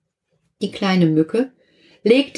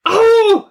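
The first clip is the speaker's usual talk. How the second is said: sudden high loud ouch; yelp of pain; 6 dB louder than usual. Acted. The lawyer stung on the nose cries out high and loud. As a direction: yelp as you get stung. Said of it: "sagte der Rechtsanwalt".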